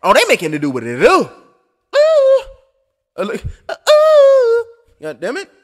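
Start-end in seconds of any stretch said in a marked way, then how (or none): 2.0-2.4 a sharp high ooh at the punch; high excited ooh. Real, not acted. Watching a big punch, high excited ooh. 3.7-4.7 a sharp high ooh at the punch; high excited ooh. Real, not acted. Watching a big punch, high excited ooh.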